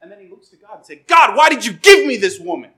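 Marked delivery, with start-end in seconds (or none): 1.1-2.7 mock accusing. Adam accusing God, acted quote.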